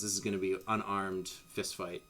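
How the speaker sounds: ordinary talk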